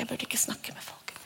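barely voiced, timid whisper. A believer silences herself, timid whisper. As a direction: whisper it as if afraid to speak. Believing the lie, quoting self.

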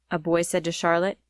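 plain reading voice

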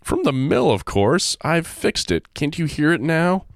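high breezy cheerful voice; cheerful friend. Acted. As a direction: answer all casual and bright. Hubert cheerful reply.

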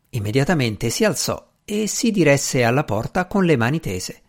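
ordinary delivery, nothing marked

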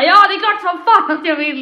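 a mock-enthusiastic loud yes; eager yes. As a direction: play it over the top, keen and loud. His eager yes, acted.

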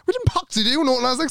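very high-pitched mock freak-out; exasperated. Traveller to panicking friend at security, high exasperated squeal.

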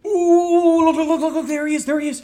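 high, loud excited squeal; can't contain it, point and yell; excited spotting. Real, not acted. Friend spots the neighbor through binoculars, loud excited squeal.

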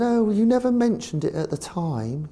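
put-on high-pitched posh accent; Eddie Rogers. Chairman answers his manager politely, mock posh high voice. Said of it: "'cause very posh, Eddie".

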